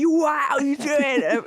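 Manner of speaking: high, loud, gushing greeting; over-the-top fan greeting. Retold or imitated. Greeting a follower in her loud online persona voice. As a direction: burst out big and bright at a fan. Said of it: "and I'd be like".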